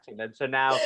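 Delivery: ordinary talk, nothing marked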